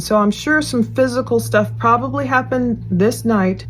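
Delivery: usual speaking voice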